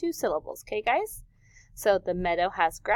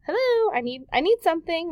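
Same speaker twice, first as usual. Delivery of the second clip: 1 pretend customer; 8 semitones higher, 4 dB louder than usual. Voicing man asking pharmacist.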